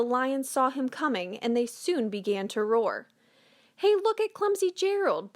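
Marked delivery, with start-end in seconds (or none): 3.8-5.3 high, mocking jeer; sneering. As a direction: sneer it at him from above. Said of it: "the animals all sneered".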